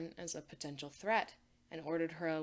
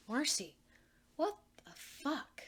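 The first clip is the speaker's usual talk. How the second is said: disbelieving; 6 semitones higher than usual. Acted. Anne stunned, disbelieving outburst.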